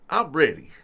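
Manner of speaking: normal talking voice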